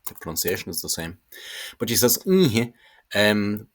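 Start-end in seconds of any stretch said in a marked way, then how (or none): 2.3-2.7 "Ihe" with a nasal vowel. Shown as a demonstration. Teacher shows the Irish word, nasal vowel. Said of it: "with the nasal vowel".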